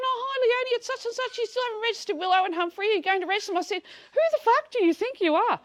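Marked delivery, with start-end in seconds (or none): 0.0-3.6 high, sing-song council phone voice; mock council woman. Mimicking the council worker on the phone, high and sugary. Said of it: "she's going". 4.1-5.6 high, loud outraged yell; furious retort. Retold or imitated. Snapping at the council caller, loud and high. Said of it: "I just lost my shit".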